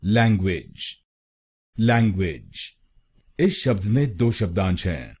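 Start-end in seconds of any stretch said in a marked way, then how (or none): 0.0-2.7 लैंग्वेज said with a clear second syllable, clean and careful; teacher modelling the right way to say लैंग्वेज; correct pronunciation. Shown as a demonstration. Teacher shows students the right pronunciation, careful and clear. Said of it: "इसकी सही pronunciation है".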